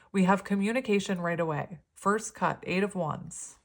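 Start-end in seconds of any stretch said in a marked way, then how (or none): none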